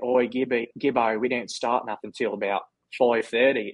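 imitating an Aussie teammate; Australian accent. Player quoting his teammates at the field, in an Australian accent. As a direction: put on a broad Australian accent. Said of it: "the ability to do an Australian accent".

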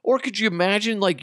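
normal talking voice

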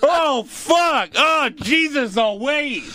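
high, shocked yelling; yelling in disgust. Retold or imitated. Friend retelling the bar bathroom guy, high shocked yelling. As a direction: shriek it like you just smelled something awful. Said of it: "And he's yelling this".

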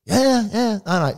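high careless yes-yes; mock boy. Boy brushing off his dad's warning, high and careless. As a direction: brush off dad like a teen.

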